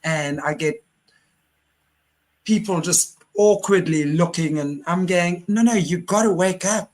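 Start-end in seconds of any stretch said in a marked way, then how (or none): 5.5-6.9 higher-pitched, insistent wake-up call; urgent plea. Retold or imitated. Host urging awkward onlookers to wake up, urgent. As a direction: plead with them to see it. Urging people who look away.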